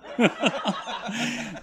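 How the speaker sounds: a high, soft burst of real laughter. Real, not acted. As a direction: laugh at the memory of the prank. Teller laughing at his own story.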